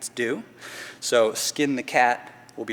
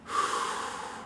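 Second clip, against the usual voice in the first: release exhale; 5 dB quieter than usual. Real, not acted. Exhale after contracting.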